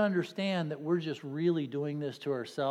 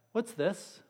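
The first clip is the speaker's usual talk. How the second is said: ordinary delivery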